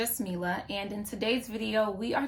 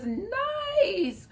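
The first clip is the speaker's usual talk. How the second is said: impressed; 13 semitones higher than usual. Retold or imitated. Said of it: "My initial reaction was".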